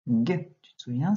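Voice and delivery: ordinary talk, nothing marked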